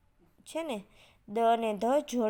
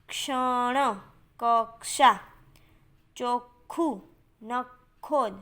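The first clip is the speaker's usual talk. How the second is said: each word drawn out, split at the double consonant; teacher's careful dictation, word by word with pauses; slow word modelling; much slower than usual. Shown as a demonstration. Teacher models conjunct words for pupils.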